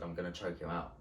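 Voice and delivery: quiet aside. Retold or imitated. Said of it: "I said that to her".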